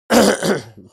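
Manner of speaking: sharp loud cough into the mic. Real, not acted. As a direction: cough hard, right into the mic. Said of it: "I just coughed".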